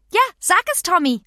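hoher, lauter Kinderruf; dazwischenrufende Pippi. A narrator's reading. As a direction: falle als Pippi eifrig ins Wort. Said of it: "fiel Pippi ein". (eifriger Zwischenruf, hoch und laut)

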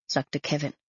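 ordinary delivery

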